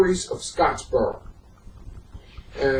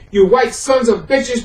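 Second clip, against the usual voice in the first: a lightly raised mock shout, not full force; read-out yell; 9 semitones higher, 5 dB louder than usual. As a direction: let the insult ring out a little, as if yelling back across the train car. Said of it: "Patterson yelled".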